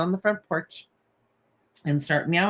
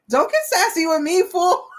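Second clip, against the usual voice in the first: mock scolding; 12 semitones higher, 3 dB louder than usual. Scolding the cat, laughing.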